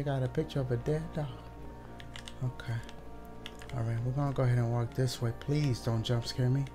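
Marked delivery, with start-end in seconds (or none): none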